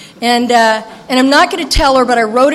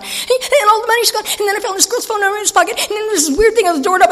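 high, fast, manic babble; frantic rambling; 9 semitones higher, 3 dB louder, faster than usual. Retold or imitated. Recovering addict rattling problems to her sponsor, frantic fast. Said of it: "I'm talking away like this".

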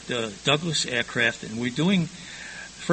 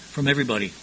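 normal talking voice

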